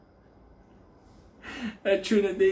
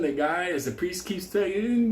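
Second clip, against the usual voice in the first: ordinary delivery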